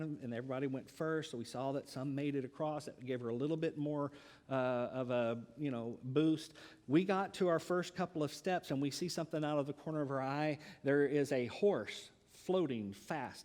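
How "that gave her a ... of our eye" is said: normal talking voice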